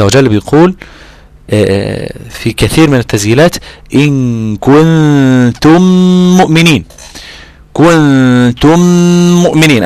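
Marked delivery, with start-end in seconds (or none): 3.9-6.8 very slow "كنتم" with long nasal hums on noon and meem; held ghunna. Shown as a demonstration. Teacher reciting a verse as example, long held ghunna in "كنتم مؤمنين". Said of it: "أنا عندي هنا إخفاءين". 7.7-9.8 very slow "كنتم" with long nasal hums on noon and meem; held ghunna. Shown as a demonstration. Teacher reciting a verse as example, long held ghunna in "كنتم مؤمنين". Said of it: "بطول فيها وبجيب مقدار الغنة".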